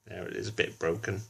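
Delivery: usual speaking voice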